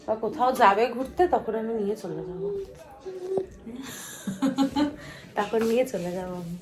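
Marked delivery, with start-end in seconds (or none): 3.0-5.3 amused chuckle to herself; a short run of laughter. Real, not acted. Vlogger laughing at her own plan to take the blanket, laugh.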